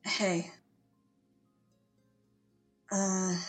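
ordinary delivery

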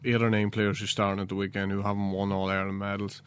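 ordinary talk

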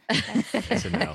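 high, slow mock refusal.

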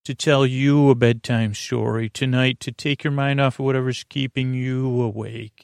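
ordinary delivery

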